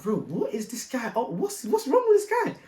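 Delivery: what on earth is this guy doing; baffled disbelief. Retold or imitated. [name] retelling his baffled reaction to the fake cop, in disbelief.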